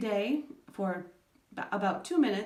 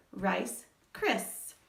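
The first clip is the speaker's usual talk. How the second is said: rice, cris said slowly as two separate beats; slow, separated rice and cris; syllable pair. Shown as a demonstration. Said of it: "just say that part".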